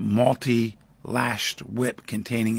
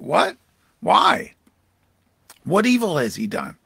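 higher, louder, appalled questions; louder higher outrage at the crowd's demand; Pilate protesting; 5 semitones higher, 6 dB louder than usual. Acted. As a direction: push back against the crowd in dismay.

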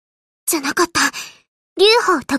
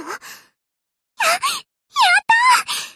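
joyful cheer; 13 semitones higher than usual. Acted. Carrier girl cheering her planes' win, stammering then squealing. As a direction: burst out cheering as if you can't believe it.

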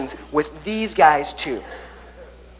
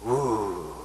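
low breathy ooh of fear; a spooky little ooh for fearfully; playful fearful ooh; mock scared ooh; 7 semitones lower, 9 dB quieter than usual. Shown as a demonstration. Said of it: "Kinda like".

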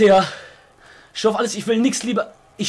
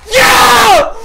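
very high, loud goal scream; triumphant shout; 12 semitones higher, 8 dB louder than usual. Real, not acted. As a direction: explode with joy at the goal. Celebrates scoring a goal.

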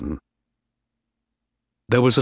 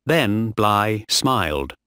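ordinary delivery

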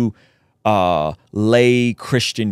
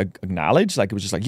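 ordinary talk

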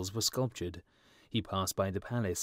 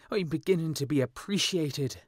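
louder, higher, delighted student; proud young writer; 7 semitones higher, 6 dB louder than usual. A narrator's reading. Student finds the sapphire and exclaims, louder and higher. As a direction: swell with pride at being noticed.

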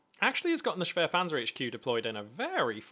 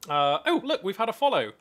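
surprised; 6 semitones higher, 8 dB louder than usual. Real, not acted. Streamer notices a new follower.

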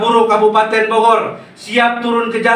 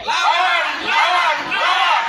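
rally shout; 5 semitones higher than usual. Real, not acted. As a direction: shout it to the crowd. Rally chant, crowd call.

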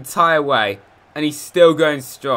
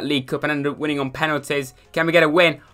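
ordinary delivery

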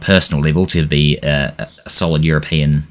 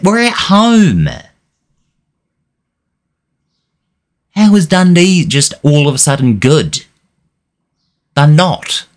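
high, loud indignant outburst; angry manager venting at his team's collapse; outraged; 10 semitones higher, 4 dB louder than usual. Real, not acted. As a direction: rage at losing at home. Losing at home to Dundee.

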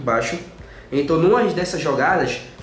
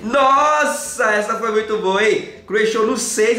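excited; 4 semitones higher, slower than usual. Real, not acted. Big win in betting game.

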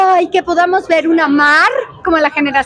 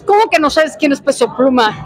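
ordinary talk, nothing marked

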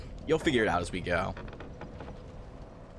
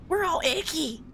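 squeaky creature voice. Pretend. Voicing the bugs.

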